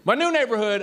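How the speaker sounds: ordinary delivery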